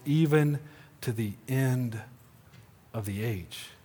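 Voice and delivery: tender reassurance. Pretend. Jesus reassuring his disciples, soft and tender. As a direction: speak softly as to a loved one. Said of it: "We say it to our loved ones".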